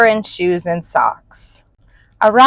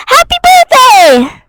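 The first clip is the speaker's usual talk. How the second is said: very high, loud joyful call from down the path; 8 dB louder than usual. A narrator's reading. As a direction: call out the greeting from afar. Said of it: "called a voice".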